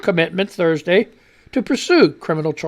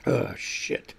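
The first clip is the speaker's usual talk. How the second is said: whispered curse; 11 dB quieter than usual. Real, not acted. Reacting after coughing fit.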